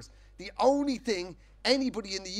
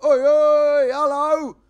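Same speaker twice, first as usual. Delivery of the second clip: loud, higher boisterous greeting; cheery hail; 8 semitones higher, 13 dB louder, much slower than usual. Retold or imitated. Ron hailing his neighbour, loud and jolly. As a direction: bellow a matey hello. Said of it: "Usually he's like".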